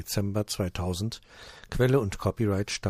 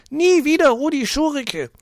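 put-on very high, loud shriek of a fat old lady; 11 dB louder than usual. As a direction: shriek it across the room. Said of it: "Eine dicke Frau kreischt".

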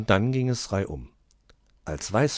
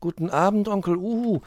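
high, louder polite greeting; children answering nicely in chorus; 13 semitones higher, 6 dB louder than usual. A narrator's reading. Children politely greet Onkel Uhu, high and bright. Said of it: "grüßten die Kinder höflich".

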